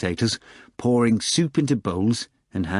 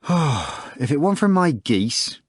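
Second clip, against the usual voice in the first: sighing; 6 semitones higher than usual. Acted. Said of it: "added with a sigh".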